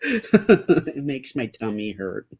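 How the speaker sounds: put-on high, slow baby voice; whiny little kid. Shown as a demonstration. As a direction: drag it out like a pouting toddler. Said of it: "I'm gonna have to say it like that".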